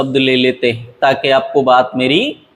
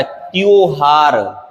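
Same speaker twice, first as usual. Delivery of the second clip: ordinary talk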